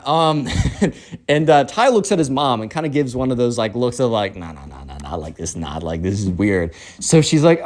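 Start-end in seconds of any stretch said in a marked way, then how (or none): 4.4-6.7 low, soft urgent no's; hushed warning. Acted. Ty warns his mom with a look, hushed warning. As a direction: murmur it low as a secret warning.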